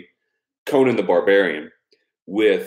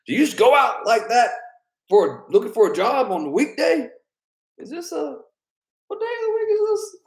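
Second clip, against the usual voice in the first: very high, thin put-on old man voice; mock old man. Quoting the Big Lebowski scolding the Dude, high mock voice. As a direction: squeak it like an indignant old man.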